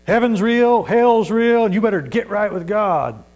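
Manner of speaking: loud, high, forceful shout; street preacher. Acted. Street preacher confronting a passerby, loud and urgent. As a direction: belt it out like a hellfire warning.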